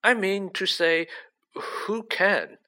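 a touch of panic; nervous official. A narrator's reading. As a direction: protest like a rattled minister. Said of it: "whose upper lip was sweating now".

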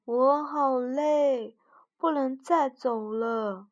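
very slow, drawn-out tired moan. A narrator's reading. Tired frog groans to mouse at sunset, moaning slowly. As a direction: drag every word, worn out. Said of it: "弗洛格呻吟着".

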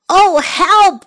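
high, loud frightened yelp; scared cry for help. Acted. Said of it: "he said".

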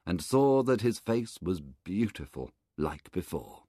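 ordinary delivery

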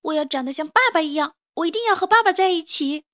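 begging to stay with dad; pleading child. Acted. 孩子哭求. (小孩子急切地恳求)

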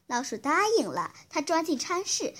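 plain reading voice

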